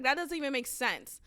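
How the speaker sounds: usual speaking voice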